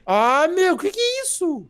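loud, very high disbelieving cry; shocked outcry. Real, not acted. Friend seeing the ugly new monster, shocked outcry. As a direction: shriek the question in shock.

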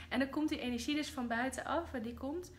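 ordinary talk

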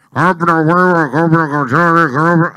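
loud, droning vendor over a loudspeaker; street buyer's call. Acted. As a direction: chant it out like a passing street buyer. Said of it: "todavía ahora pasa el". (pregón de comprador ambulante por altavoz)

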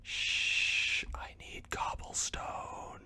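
hushed whisper, very soft. Pretend. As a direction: breathe it out secretly. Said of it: "kinda sound like".

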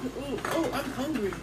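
ordinary delivery

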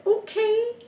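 tiny high-pitched magic charm; very high talking-object voice; paper charm's voice. A narrator's reading. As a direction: answer in a squeaky little voice. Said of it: "the paper charm said".

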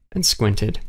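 ordinary delivery

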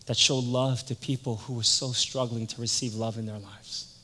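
ordinary delivery

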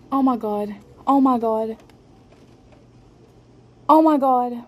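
ordinary talk, nothing marked

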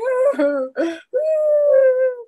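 long high-pitched wail, loud; high wailing sob. A narrator's reading. Little girl sitting on a rock by the lane: high wailing sob. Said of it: "she's just crying".

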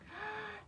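excited gasp. Acted. Excited gasp before next verse.

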